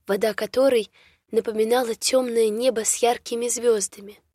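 ordinary delivery

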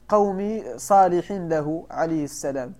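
ordinary talk

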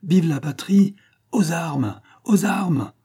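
high, loud call to arms; rallying cry. A narrator's reading. Pitou calls the patriots to arms, a rallying cry.

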